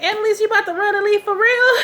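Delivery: high, fast, disbelieving squeal at the character; mock outrage, voice shooting up; incredulous high cry. Viewer shouting at Annalise on screen, high and incredulous.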